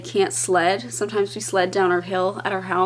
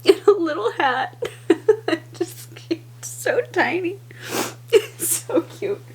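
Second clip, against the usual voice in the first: tearful giggling; 8 semitones higher than usual. Real, not acted. Mom-to-be holding up a tiny hat, giggling tearfully. Gushing over tiny baby clothes.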